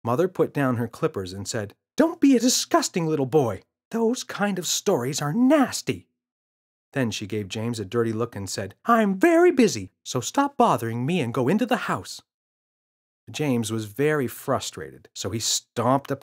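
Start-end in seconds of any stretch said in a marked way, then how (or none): 2.0-6.1 scolding mother. Acted. 8.9-12.2 irritated mother. Acted. Mother dismissing son.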